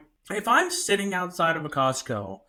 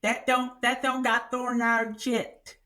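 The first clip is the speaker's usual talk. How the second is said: imitating the woman; 5 semitones higher than usual. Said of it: "And she goes".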